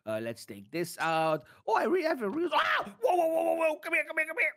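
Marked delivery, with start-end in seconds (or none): 2.5-2.8 short, very high shriek; sudden high yelp of fright; startled scream. Acted. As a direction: jump out of your skin. Something jumps out of chest.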